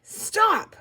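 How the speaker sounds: put-on high, piercing child's yell; loud high-pitched shout of protest. A narrator's reading. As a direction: yell it suddenly at full voice. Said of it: "yelled very loudly".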